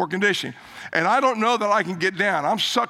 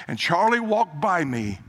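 ordinary talk

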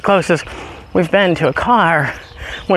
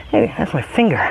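ordinary talk, nothing marked

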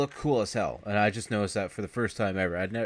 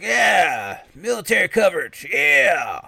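much louder, higher manic voice; hammed-up crazy vet; 8 semitones higher, 9 dB louder, slower than usual. Bronson reacting to a plane overhead, loud and manic. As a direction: rave like a crazed veteran.

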